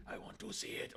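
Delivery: soft, whispered mock begging; creepy whisper. Mimicking a creep who begs to see, whispering.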